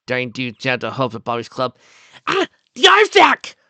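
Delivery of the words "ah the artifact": very high, loud cry of discovery; excited shout. A narrator's reading. Daring Do spotting the artifact, excited shout. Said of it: "Daring Do shouted".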